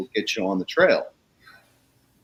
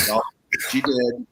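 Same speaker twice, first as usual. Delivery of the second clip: ordinary talk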